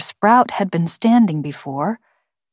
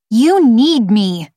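put-on slow, high child's protest; emphatic, drawn-out girlish insistence; insistent girl; 5 semitones higher, 5 dB louder, slower than usual. A narrator's reading. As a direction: press each word, louder and higher. Girl protests her dismissal.

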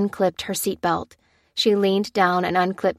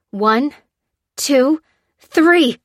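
straining count, rising to a shout; crying out; 7 semitones higher, 6 dB louder, much slower than usual. Acted. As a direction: count it out, louder on two three. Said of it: "cried Lucy".

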